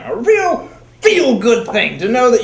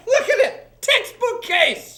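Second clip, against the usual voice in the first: mock excitement; 10 semitones higher than usual.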